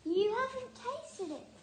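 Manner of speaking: high urgent call. A narrator's reading. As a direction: urge him on, high. Wombat urging Dingo.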